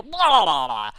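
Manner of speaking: loud blubbering splutter; disgusted sputtering. Retold or imitated. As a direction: splutter it out of your mouth. Sputters squash from face.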